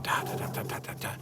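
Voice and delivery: soft unvoiced ta-ta-ta of keys clicking; typing noise. Imitating his keyboard as he typed his testimony, whispered clicks. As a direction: tap out the keystrokes under your breath.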